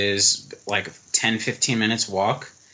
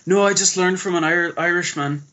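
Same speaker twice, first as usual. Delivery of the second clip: put-on higher voice with a light Irish accent; imitated Irish accent; 7 semitones higher than usual. German pub guy explaining himself, in an imitated Irish accent. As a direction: imitate a German who speaks English like an Irishman. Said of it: "he talks in a total Irish accent".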